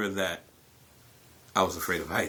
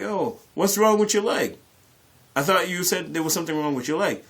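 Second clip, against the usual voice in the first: teasing question, pitched much higher; mocking friends; 7 semitones higher than usual. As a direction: needle him about the leg like the guys did. Friends mocking his fake injury.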